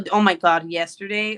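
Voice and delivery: ordinary delivery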